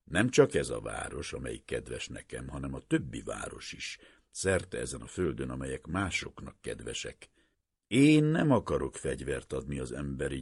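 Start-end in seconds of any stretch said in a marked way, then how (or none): none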